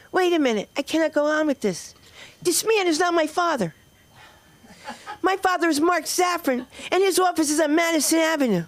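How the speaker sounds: put-on high-pitched child setting the record straight; indignant daughter. Daughter interrupting mom's audition to name her real father, indignant high voice. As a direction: pitch it up high, protesting like a little girl.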